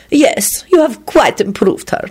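complacent. A narrator's reading. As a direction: approve with smug complacency. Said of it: "his host replied complacently".

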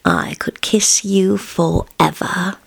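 slow, voiceless whisper. A narrator's reading. Husband whispering to his wife, slow and voiceless. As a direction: breathe it out slowly, adoring.

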